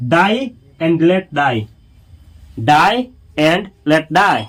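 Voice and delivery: drawn out, word by word; slow model reading. Shown as a demonstration. Teacher drilling the phrase for students, very slow. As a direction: say it slowly for learners. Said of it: "teacher models the English phrase".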